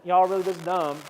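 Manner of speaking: put-on southern twang; southern accent. Jesus scolding his disciples, in a southern accent. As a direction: lean into a mock southern accent. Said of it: "I think Jesus had a southern accent".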